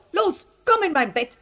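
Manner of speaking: high, loud, hurried invitation; urgent boy. A narrator's reading. Paul hiding the elephant in his bed, urgent. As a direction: urge him in with a high boy's voice.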